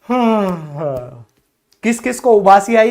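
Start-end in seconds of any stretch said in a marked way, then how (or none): 0.0-1.3 long yawn sighing downward and fading; demonstrated yawn. Shown as a demonstration. Teacher tempting viewers to yawn along, yawning. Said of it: "एक बार करके देखो".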